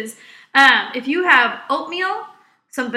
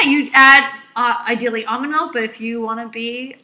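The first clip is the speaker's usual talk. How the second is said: normal talking voice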